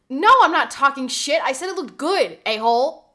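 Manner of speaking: loud, indignant comeback; defensive protest. Real, not acted. Streamer answering haircut accusation, loudly defensive. Snapping back at Royce off mic.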